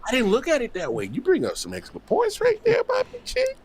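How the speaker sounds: very high laughing praise; laughing squeal. Real, not acted. Host laughing hard at his cohost's points.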